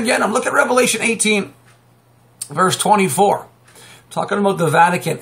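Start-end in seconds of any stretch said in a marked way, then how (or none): none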